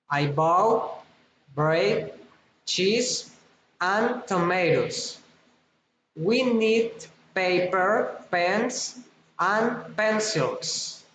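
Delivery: items rise, the last one after "and" falls; rising list intonation. Shown as a demonstration. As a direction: lift "bread", "cheese", "pens", drop on "tomatoes", "pencils". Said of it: "rising intonation".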